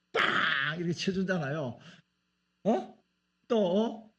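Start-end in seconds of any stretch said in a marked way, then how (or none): none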